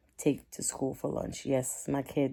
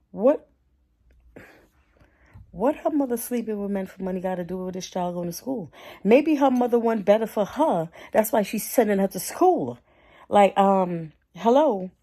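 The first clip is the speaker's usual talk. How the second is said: outraged; 5 semitones higher, 6 dB louder than usual. Real, not acted. Outraged at his words.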